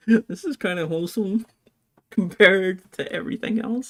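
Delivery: giggling while saying "kinda wholesome"; laughing. Real, not acted. Same words repeated laughing.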